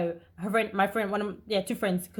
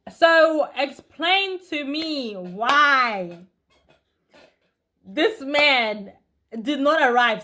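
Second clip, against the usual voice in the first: indignant; 3 semitones higher, 5 dB louder, much slower than usual. Real, not acted. Outraged at being stood up.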